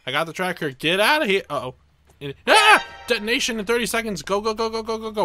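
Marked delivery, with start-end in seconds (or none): 2.2-2.8 very high, loud shriek cutting off a word; piercing startled shriek; startled scream. Real, not acted. Sudden explosion alarm. 3.1-5.2 panicked urgency. Real, not acted. Countdown panic run.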